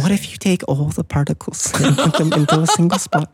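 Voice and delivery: tiny little English voice, breaking into laughter; Brian Cox. Acted. Podcaster imitating a physicist for his co-host, tiny English voice. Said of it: "that tiny little English voice".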